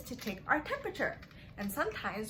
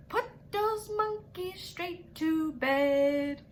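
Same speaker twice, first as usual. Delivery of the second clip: slow sing-song order, a bit louder; doctor's order; 8 semitones higher, 5 dB louder, much slower than usual. Acted. Rhyme doctor ordering monkeys to bed, mock stern. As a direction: stretch it out like a gentle command. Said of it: "the doctor said".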